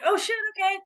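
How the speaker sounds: sudden high "oh, shit"; startled realization. Retold or imitated. Athlete realizing her teammates slack, surprised. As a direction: caught off guard, pitch jumps up.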